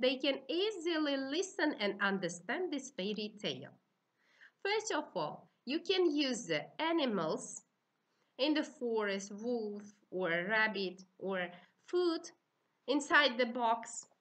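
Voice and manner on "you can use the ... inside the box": ordinary delivery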